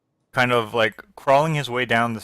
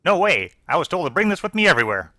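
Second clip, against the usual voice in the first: put-on high, quick protesting kid; young boy; 6 semitones higher, faster than usual. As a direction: play a defiant little boy, pitched up. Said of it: "And the boy, the voice says".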